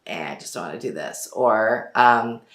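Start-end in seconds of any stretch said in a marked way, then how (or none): 0.1-1.3 low, soft and fast, can't be bothered; lazy low shrug. Retold or imitated. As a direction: shrug it off, lower and quieter. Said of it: "more like".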